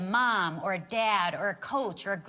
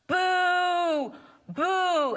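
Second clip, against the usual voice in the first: higher, louder mock booing crowd; 9 semitones higher, 6 dB louder than usual. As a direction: boo him like a hostile stadium crowd. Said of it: "as Dave's booing".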